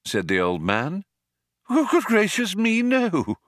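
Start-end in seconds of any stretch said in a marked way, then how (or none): 1.7-3.4 put-on very high, fluttering old man; high-pitched, astonished protest; shocked denial. A narrator's reading. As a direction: deny it, quite taken aback. Old man denies being dead.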